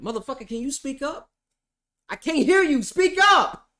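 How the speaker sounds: building to a high, loud shout; exasperated demand. Acted. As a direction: yell up at someone you can't hear. Exasperated demand to quiet judge.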